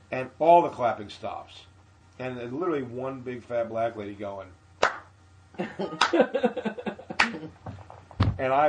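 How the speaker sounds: usual speaking voice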